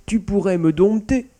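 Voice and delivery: high, loud, desperate offer; pleading. A narrator's reading. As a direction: offer it as a begging, cornered cat. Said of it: "supplia-t-il". (offre suppliante)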